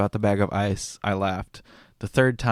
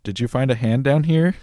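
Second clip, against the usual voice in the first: mock question; 4 semitones higher, 7 dB louder than usual. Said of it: "quoting the maintenance guy".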